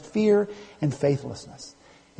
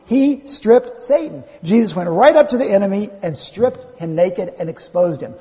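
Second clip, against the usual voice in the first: emphatic, higher-pitched pulpit delivery; full-force preaching; 7 semitones higher, 6 dB louder than usual. Real, not acted. Preacher telling the congregation of Satan's defeat, full-force preaching. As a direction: preach it with conviction, driving key phrases home.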